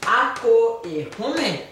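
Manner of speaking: "Ako e home" said slowly, each syllable clear; slow model. Shown as a demonstration. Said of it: "Oui, très bien". («Ako e home» dit lentement, syllabe par syllabe)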